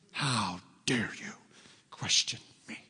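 whispered, slow and affronted; slow offended whisper; mock indignant whisper. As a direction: hiss it like wounded pride. Imitating righteous indignation.

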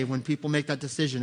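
ordinary talk, nothing marked

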